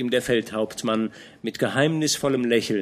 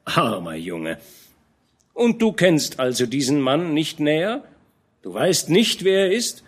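a hint of a fatherly knight, raised and earnest; old general scolding a young knight, lightly voiced; lightly voiced old commander; 6 semitones higher, 5 dB louder than usual. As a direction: admonish the young man gently but firmly. Fronsberg pities and questions.

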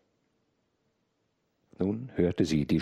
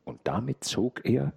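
ordinary delivery

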